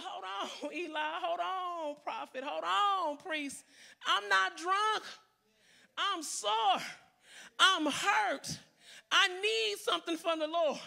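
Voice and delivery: Hannah begging the priest, growing to a cry; desperate Hannah pleading. Retold or imitated. Hannah pleads with the priest, desperate rising cry. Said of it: "She said".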